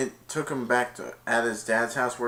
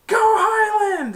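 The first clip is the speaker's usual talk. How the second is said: high, drawn-out shouted chant; victory cheer; 9 dB louder, slower than usual. Retold or imitated. Dizzy after Highland wins, long screamed cheer. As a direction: cheer it at full volume.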